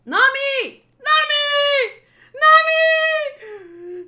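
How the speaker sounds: high, loud screams for someone lost; wailing full-voiced shout; desperate calling. Alone, calling for missing friend.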